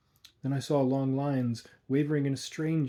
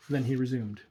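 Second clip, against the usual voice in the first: plain reading voice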